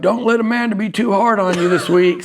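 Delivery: high, playful teasing fan voice. Retold or imitated. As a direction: tease her like a friendly usher. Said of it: "he's like".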